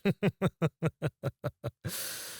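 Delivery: high, rippling laugh; long, amused chuckle pitched high; mocking laugh. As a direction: laugh at their helpless fall. Captor amused by victim's fall.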